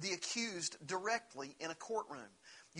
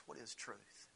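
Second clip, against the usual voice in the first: much softer, lower, world-weary question; jaded; 6 semitones lower, 12 dB quieter than usual. Retold or imitated. Pilate, worn by corruption, answering Jesus: jaded and soft. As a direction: toss it off like a tired cynic. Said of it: "the jaded answer of a man".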